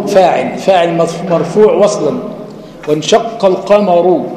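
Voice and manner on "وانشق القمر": وانشق القمر slow, ending القمر on a voiced final u; connected reading. Shown as a demonstration. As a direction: read وانشق القمر joined, with damma on the r. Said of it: "الراء هنا مضمومة في الوصل". (وانشق القمر وصلاً ببطء مع ضم الراء)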